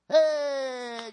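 long, loud, high hey; drawn-out shout for applause; rousing cheer. Real, not acted. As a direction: call out to rally the crowd.

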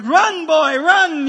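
loud, laughing "go, go!"; dad hollers at his son to run to the bathroom, laughing; laughing shout. Retold or imitated. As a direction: holler it while cracking up.

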